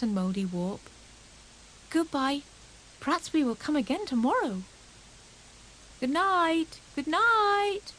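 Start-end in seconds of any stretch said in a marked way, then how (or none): 1.9-4.6 higher, cheerful farewell; Grey Rabbit. A narrator's reading. 6.0-7.9 high, louder calls; calling goodnight. A narrator's reading. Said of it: "resounded round the pond".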